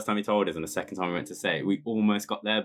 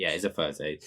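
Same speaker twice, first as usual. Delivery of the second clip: ordinary delivery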